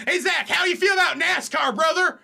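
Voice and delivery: high, loud put-on rally shout; mock MAGA fan. Friend asking Zach about NASCAR, loud mock MAGA fan. Joking MAGA character carried on.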